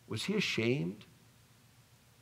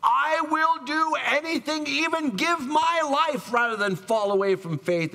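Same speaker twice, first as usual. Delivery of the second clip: much higher, louder, vowing Peter; boastful Peter; 6 dB louder than usual. Retold or imitated. Peter loudly vowing loyalty to Jesus, high and strained. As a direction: swear it loudly like a proud disciple. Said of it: "the Peter who said".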